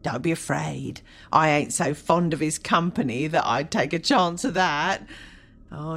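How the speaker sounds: put-on coarse, cackling old charwoman; chatty grumbling charwoman. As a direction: grumble and gloat over stolen goods. Charwoman haggling with pawnbroker.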